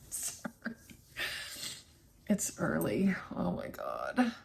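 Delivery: unvoiced whisper, barely there; early-morning whisper, embarrassed; whispered apology. Real, not acted. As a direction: breathe it out in a whisper. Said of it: "I'm really mumbling".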